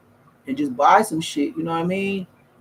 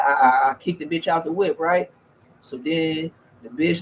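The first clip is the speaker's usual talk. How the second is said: ordinary talk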